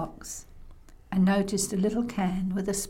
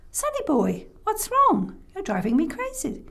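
higher-pitched, worried scolding; exasperated grandma; 8 semitones higher than usual. Acted. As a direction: demand to know what's wrong, fed up. Grandma runs back, scolds.

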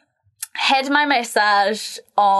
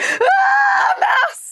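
very high mock shriek of alarm; imitated scream; 3 dB louder than usual. Therapist screams again at the mouse, imitated shriek. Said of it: "she screams again".

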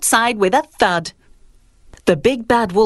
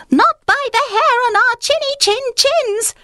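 much higher squeaky piglet voices; squeaky little pigs. Acted. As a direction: squeal it out like tiny defiant pigs. Pigs refuse together.

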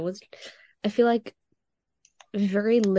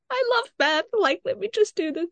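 much higher, mock brave through tears; forced cheer; 11 semitones higher than usual. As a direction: squeak it out holding back tears. Own words while tearful.

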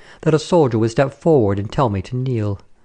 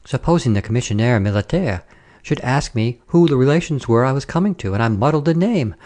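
plain reading voice

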